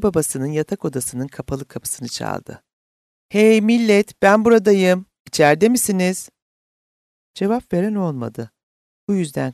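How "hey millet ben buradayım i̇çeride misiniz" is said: loud, raised call through a door. A narrator's reading. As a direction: announce yourself to people in the next room. Calling through parents' closed bedroom door. (yüksek sesle seslenerek)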